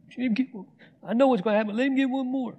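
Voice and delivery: high, eager urging with a laugh; excited cheering. Retold or imitated.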